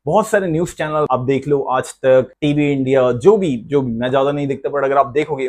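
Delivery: normal talking voice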